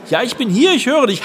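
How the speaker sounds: high, loud reply. Retold or imitated. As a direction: call back over a bad line.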